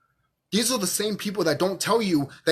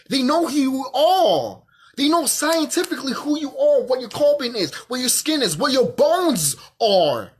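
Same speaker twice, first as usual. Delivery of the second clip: heated, insistent retort; emphatic insistence; 6 semitones higher, 4 dB louder than usual. Real, not acted. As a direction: drive each point home, stretching the key words. Heated retort to fearful people.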